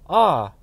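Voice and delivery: sharp loud "Ah!" grunt of recognition; loud startled grunt. A narrator's reading. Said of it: "Valored grunted".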